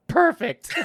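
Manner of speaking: very high, loud laughing sarcasm; sarcastic answer to the lifeguards; sarcastic perfect. Retold or imitated. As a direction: throw it off with a laugh. Sarcastic reply to drowning news.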